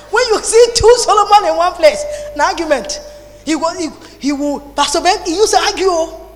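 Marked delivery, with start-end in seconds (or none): none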